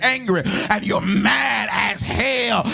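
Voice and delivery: rolling, half-sung sermon climax; full-force preaching. Real, not acted. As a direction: preach it in a relentless, chanting build.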